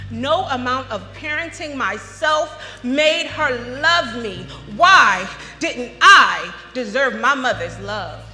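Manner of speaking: slow, loud, emphatic lament; anguished outcry. Acted. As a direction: build to a loud, drawn-out plea. Anguished climax about mother's neglect.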